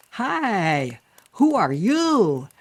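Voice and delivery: high, loud, slow swooping greeting; wide-mouthed frog. Acted. Frog greeting a heron, loud and wide-mouthed. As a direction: holler it with vowels stretched wide. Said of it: "trying to open my mouth really wide".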